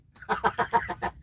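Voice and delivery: breathless airy laugh at the pun; real laugh. Real, not acted. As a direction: let a soft wheezy laugh out.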